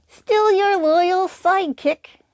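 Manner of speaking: high, slow puppet sidekick voice; a cheerful little puppet talking. Acted. Puppet Periwinkle speaks.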